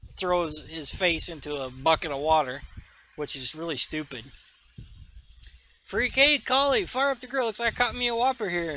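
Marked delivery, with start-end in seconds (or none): 5.8-8.8 raised, high-pitched heckler's taunt; rowdy barfly playing to the crowd; jeering shout-out. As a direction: call across the bar, mocking the stranger. Barfly calling out a taunt to cook.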